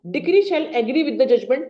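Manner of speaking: drawn out, word by word; slow dictation. Shown as a demonstration. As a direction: repeat the rule slowly for notes. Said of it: "reading the rule again". (दोबारा धीमे लिखवाना)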